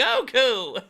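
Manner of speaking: put-on high laughing old man call. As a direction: call out like a wobbly old master. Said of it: "My Roshi's not good".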